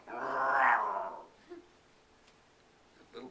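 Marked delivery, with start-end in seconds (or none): 0.1-1.2 loud drawn-out growl; tiger growl. Acted. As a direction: snarl back like angry tigers. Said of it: "the tigers only answered".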